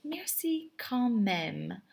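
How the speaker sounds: Merci quand même said slowly with a rise; drawn-out Merci quand même; slow teacher demo; slow model phrase. Shown as a demonstration. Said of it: "you would say".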